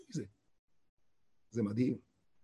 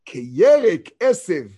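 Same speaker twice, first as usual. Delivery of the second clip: reading slowly; 6 dB louder, much slower than usual. Shown as a demonstration. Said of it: "עכשיו אני קורא בנוח".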